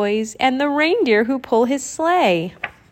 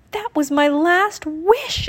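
exclaiming; 8 semitones higher than usual. Acted. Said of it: "exclaimed Leah".

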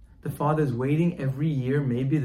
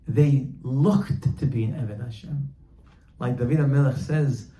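normal talking voice

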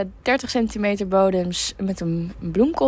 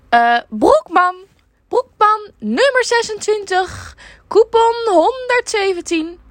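high, loud, slow shrill customer; old lady voice; 13 semitones higher, 6 dB louder, slower than usual. Retold or imitated. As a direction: squawk it like an old lady caller. Caller lady voiced in story.

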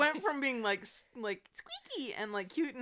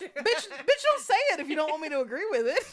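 fast, higher, laughing scold; mock outrage; 5 semitones higher, 5 dB louder than usual. Real, not acted. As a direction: scold playfully through laughter.

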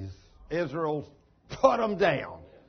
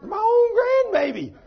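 much higher, louder, slow outcry; amazed exclamation; 12 dB louder, slower than usual. Real, not acted. Grandfather marvelling to the church at his grandson, loud and high. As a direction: cry out in laughing disbelief.

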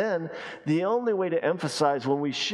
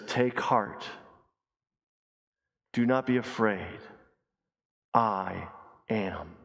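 hushed solemn reassurance; 5 semitones lower, slower than usual. Acted. Jesus to the terrified disciples, hushed solemn reassurance. Preacher voicing Jesus' climactic words.

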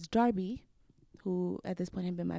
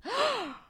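gasp. Retold or imitated. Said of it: "I was just like".

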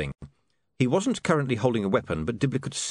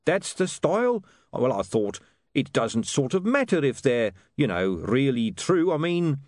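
lightly voiced huckster, higher pitch; hedging salesman, raised pitch; Dibbler, hedging; 6 semitones higher than usual. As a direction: justify yourself, a little unsure.

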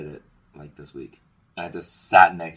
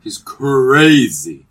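amazed; 8 semitones higher, 14 dB louder, much slower than usual. Real, not acted. Amazed at coworker's skill.

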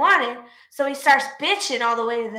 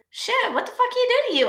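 much higher, startled question; surprised clerk; 11 semitones higher than usual. Retold or imitated. Clerk asking the cashier what happened, much higher surprise. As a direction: react with shocked curiosity. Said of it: "the self-checkout clerk goes".

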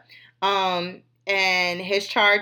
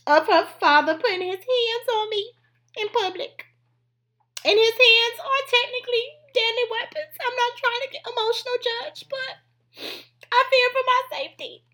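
hammed-up tearful plea, climbing much higher; Drita pleading in court; 13 semitones higher than usual. Host pretends to be Drita before the judge: mock distraught, much higher. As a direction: play the wronged mother begging the judge, voice rising to a squeak. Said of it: "if I was Drita".

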